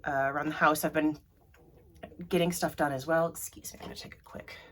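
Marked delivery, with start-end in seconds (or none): 3.3-4.6 breathy whisper, much softer; a quick whispered excuse before stepping away; whispered aside. Real, not acted. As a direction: murmur an excuse off to the side.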